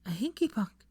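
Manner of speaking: breathy whisper, heart sinking. A narrator's reading. Hermione whispering in dismay to Draco. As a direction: whisper it as it dawns on you. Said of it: "she whispered".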